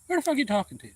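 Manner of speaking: high, loud angry snap; angry retort. Retold or imitated. Boy snapping back at the bully, loud and high.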